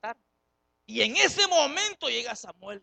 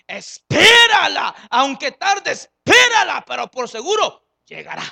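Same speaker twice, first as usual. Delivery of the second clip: fervent shout; 4 semitones higher, 16 dB louder than usual. Acted. Preacher quotes the prophet.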